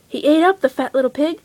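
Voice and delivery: plain reading voice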